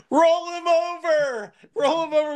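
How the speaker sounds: high-pitched panicked mock scream. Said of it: "she literally screamed".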